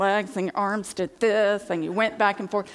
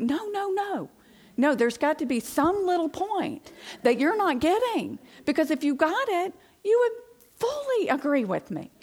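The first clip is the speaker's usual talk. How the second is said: high, pleading mock insistence, far above her usual pitch; insistent inner voice; 10 semitones higher, 5 dB louder than usual. Wife in her head, sure husband misses the point, high and insistent. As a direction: talk yourself into being right. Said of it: "I think".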